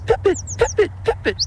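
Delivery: high chirpy pipit call; bird call imitation. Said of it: "this little streaky brown bird saying".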